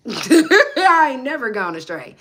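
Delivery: laughs, then drawls it out slowly and loudly; laughing denial. Real, not acted. Laughing it off as she answers a commenter, slow and loud. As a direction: scoff it off as ridiculous, stretching every word.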